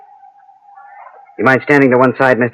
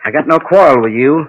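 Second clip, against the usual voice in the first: louder, higher warning to a stranger; raised threat; 6 semitones higher, 5 dB louder than usual. Acted. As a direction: push it hard at the stranger. Gunman threatening in street.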